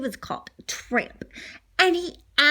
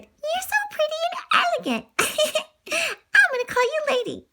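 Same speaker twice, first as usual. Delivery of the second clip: high, sweet, giggly praise; sweet mom voice. Retold or imitated. Mom naming her new puppy, high and giggly. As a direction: coo over the new puppy. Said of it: "And she said".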